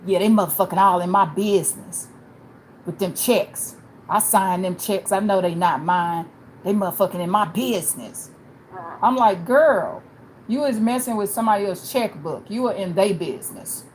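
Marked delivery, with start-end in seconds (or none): 0.1-8.3 loud, griping inmate; loud imitation of a complaining inmate. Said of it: "she was talking about". 9.4-13.8 loud, scolding comeback; retold loud argument; arguing back. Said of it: "So I was arguing with them women".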